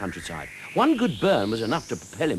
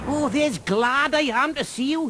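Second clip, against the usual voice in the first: high, slow Welsh dragon voice, overjoyed; a delighted Welsh dragon greeting old friends; glad Welsh dragon cry; slower than usual. Acted. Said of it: "he cried".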